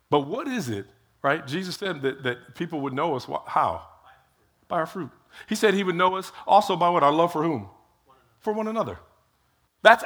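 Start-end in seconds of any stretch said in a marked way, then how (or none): none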